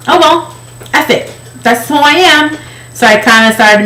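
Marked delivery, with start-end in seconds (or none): 0.1-2.6 much higher, flippant don't-care voice; defiant shrug. Retold or imitated. Giving up on herself, higher flippant shrug. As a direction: toss it off carelessly.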